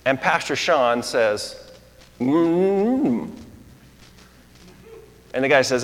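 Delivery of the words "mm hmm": wordless closed-mouth hum, swooping up then dropping; unintelligible mumble. Acted. Joke's pastor answers the puzzled fisherman, unintelligible muffled mumble. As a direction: mumble a whole answer through shut lips. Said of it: "he can't understand".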